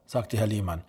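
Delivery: plain reading voice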